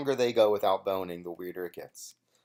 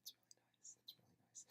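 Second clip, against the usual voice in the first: softer whispered awe; reacting to a touching moment, whispered; 35 dB quieter, much faster than usual. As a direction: whisper it as if moved. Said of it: "I'm just like".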